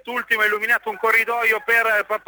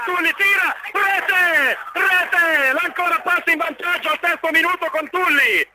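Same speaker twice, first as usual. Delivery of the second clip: breathless italian goal commentary; 4 semitones higher than usual. Real, not acted. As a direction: shout the goal, rising with joy. Telecronaca radio, gol dell'Ancona. (telecronaca del gol, urlata e concitata)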